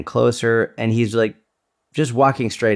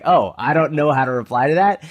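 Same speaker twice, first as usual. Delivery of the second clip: higher-pitched, mock bewilderment; retold puzzlement, thrown off balance; taken aback; 5 semitones higher than usual. Imitating a mugger stumped by a greeting, taken aback. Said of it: "he was so taken aback".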